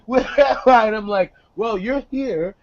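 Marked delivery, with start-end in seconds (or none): none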